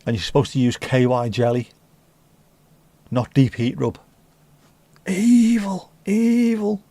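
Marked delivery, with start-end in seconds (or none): 5.1-6.8 high, squeaky mock villain; mock evil voice. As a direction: squeal it out like a cartoon baddie.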